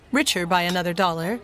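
ordinary delivery, nothing marked

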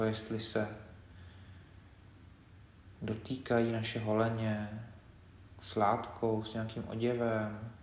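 quiet, unhurried meditation leader; guided meditation. Acted. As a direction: guide them gently through the body, leaving space between phrases. Leads a body scan meditation.

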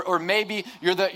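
ordinary delivery

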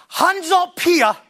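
slow, drawn-out preacher call; hamming up a revival altar call; mock altar call. Preacher mocking an altar call to the congregation, drawn out slowly. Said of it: "am I mocking it".

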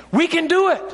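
high, rousing cry to the crowd; mock scream. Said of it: "and he screams".

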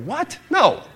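high, startled what then a flat no; shocked refusal. Acted. Dad to daughter asking for booze, shocked high refusal. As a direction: squeak out the disbelief, then shut it down.

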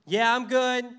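flippant. Retold or imitated. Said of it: "Doesn't say that".

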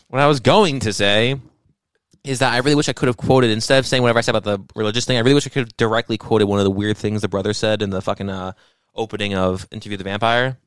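ordinary talk

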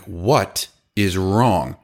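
very slow, heavily spaced words; bewildered demand. Retold or imitated. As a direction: stretch out each word in retold disbelief. Retold reaction to screaming employee.

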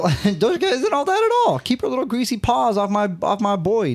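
high-pitched playful whine; high mock jealous. Real, not acted.

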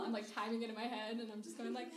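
usual speaking voice

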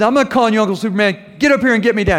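high, loud, fast panicked plea from a tree; panicked yell. Retold or imitated. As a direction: yell it scared, stuck on a cracking branch. Nephew stuck in tree, panicking.

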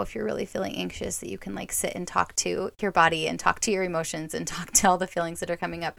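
normal talking voice

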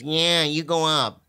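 imitated Mitzi. Mitzi sending the new comic up, slow drawling impression. Retold Mitzi's drawn-out reply.

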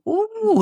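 high mock student whoop; imitated hooting. Students watching their teacher moonwalk, high hooting "ooh". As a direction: hoot like an impressed class of kids. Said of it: "and hooting and hollering".

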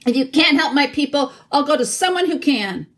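understated scream, pitched high; mild scream. A narrator's reading. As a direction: voice climbing towards a scream, held in. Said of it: "now rose to a scream".

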